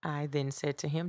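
usual speaking voice

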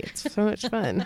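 ordinary talk

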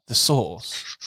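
usual speaking voice

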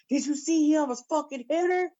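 high-pitched indignant imitation; hammed-up high-voiced outrage; mimicked outraged bystander. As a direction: squawk it high, scandalised.

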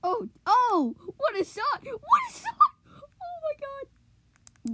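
high excited shouting; cheering at a game. Real, not acted. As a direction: shriek with amazement at the shot. Reacting to a great shot.

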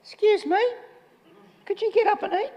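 high, hammed-up overly polite request; mock polite. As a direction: mince it like a timid butler. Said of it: "mock polite angel asking".

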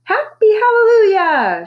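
high, loud, slow drawn-out cry; girl shouting her happiness from the bridge; joyful shout. A narrator's reading. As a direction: shout it to the sky with joy. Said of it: "to shout".

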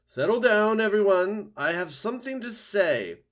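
high, loud owl calling a crowd to order; hooting owl. A narrator's reading. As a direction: hoot it out over the whole gathering. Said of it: "Swooper the owl hooted to the crowd".

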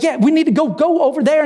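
high-pitched urgent plea; mild mock scream. Dad spots his son in the water, screaming urgently. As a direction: act out a desperate father, pitch way up without full volume. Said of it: "he's screaming at the, the guy".

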